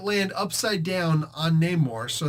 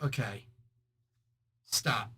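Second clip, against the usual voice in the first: much softer, low okay, stop; calm halt; 5 semitones lower, 8 dB quieter, slower than usual. Retold or imitated. Cap calms Namor, soft and low. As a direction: soothe him into stopping. Cap calmly halts the fight.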